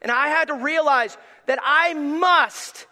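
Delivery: a pastor preaching his humility before God at full force. Acted. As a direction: build to a shouted sermon peak. Preaching climax.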